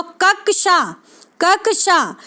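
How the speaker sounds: "कक्षा" slowly as kak-shaa; teacher's deliberate "कक्षा", k sounded before sh; careful model pronunciation. Shown as a demonstration. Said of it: "इसको pronunciation ठीक से करना सीखें".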